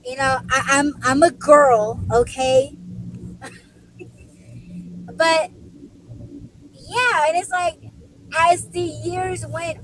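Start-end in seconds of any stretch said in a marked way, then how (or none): none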